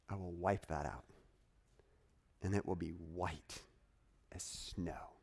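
soft and low, fading to a whisper; God's gentle promise. Acted. Preacher voicing God's promise to the congregation, hushed. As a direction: let it fall to a hush.

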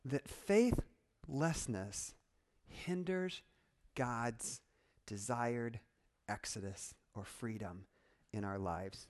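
softly, one weighted piece at a time with long pauses; slow, deliberate repeat. Shown as a demonstration. Preacher repeats his warning to the congregation, slow and solemn. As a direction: let it sink in, dropping low toward the end.

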